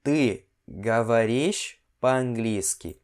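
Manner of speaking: very slow, syllable by syllable; slow repeat. Shown as a demonstration. Teacher slowly modeling a Russian phrase for students, drawn out. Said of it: "essa aqui, ó".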